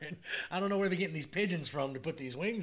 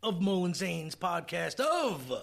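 announcer voice; 5 semitones higher, 4 dB louder, much slower than usual. Acted. Announces the podcast episode.

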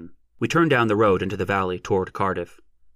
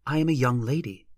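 put-on higher, earnest young lady; serious; 4 semitones higher than usual. A narrator's reading. Young lady insisting on her standing, serious. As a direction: state it seriously, pitch lifted. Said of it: "she said seriously".

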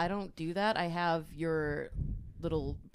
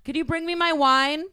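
loud, higher call to husband offstage; Karen calling; 7 semitones higher, 8 dB louder than usual. Acted. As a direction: yell across the house for the wine.

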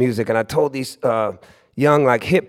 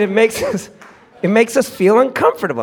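high, louder mock complaint; imitated kid's pained objection; indignant young kid; 8 semitones higher, 4 dB louder than usual. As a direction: push the protest up high and insistent. Kid's protest resumed after aside.

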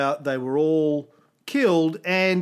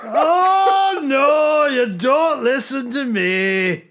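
high, loud, drawn-out wail; hammed-up imitation, wailed high and loud; mimicked film line; 10 semitones higher, 6 dB louder, much slower than usual. Cohost mimicking the film clip they just played, high drawn-out wail.

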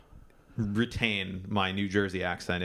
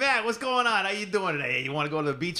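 Staten Island guy; 6 semitones higher than usual. Shown as a demonstration. Showing co-host his Jersey-type character, hammed-up Staten Island accent. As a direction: holler a big Staten Island greeting. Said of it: "when you do that one character".

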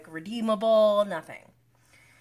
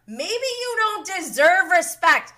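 high, loud, fired-up comeback; indignant retort; 8 semitones higher, 5 dB louder than usual. Real, not acted. Viewer answering him as he blames her, indignant. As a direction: snap back at him, incensed.

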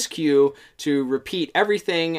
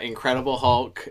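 normal talking voice

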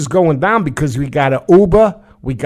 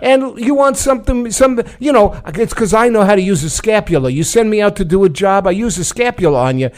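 mock mobster; 5 semitones higher than usual. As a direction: ham up a New York mob enforcer. Playing mobster Paulie.

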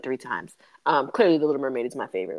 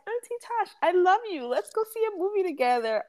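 cousin's sweet voice; 14 semitones higher than usual. Retold or imitated. Aunt imitating her cousin asking for a movie, high sweet. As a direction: coo it like an adoring nephew. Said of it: "The fact that he was like".

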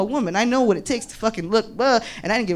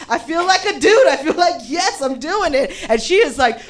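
ordinary talk

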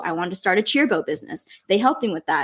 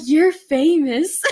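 1 starstruck gush; 5 semitones higher, 5 dB louder, much slower than usual. Retold or imitated. Graduation staff recognizes her.

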